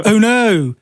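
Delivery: mock half-hearted "oh no"; lightly imitated forced excitement; fake enthusiasm. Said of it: "almost like he was trying to feign enthusiasm".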